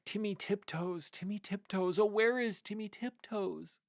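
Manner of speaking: high anxious calling. A narrator's reading. Goody calls through the wood for her husband, high and anxious.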